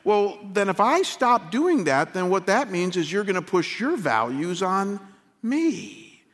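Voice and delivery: ordinary talk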